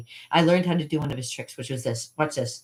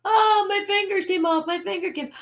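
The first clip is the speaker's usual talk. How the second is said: very high fake agony; mock panicked wailing. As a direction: wail as if your fingers just fell off. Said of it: "Imagine there was somebody going".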